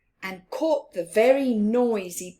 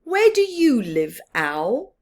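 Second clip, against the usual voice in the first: louder curious fox; louder curious question; fox asking; 7 dB louder than usual. Acted. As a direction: call up to the owl. Fox asks owl.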